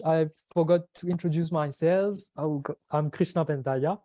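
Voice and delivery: usual speaking voice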